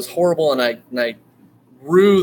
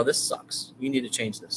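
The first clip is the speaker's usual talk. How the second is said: quiet aside; 10 dB quieter than usual. Retold or imitated. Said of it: "she's also allowed to tell me".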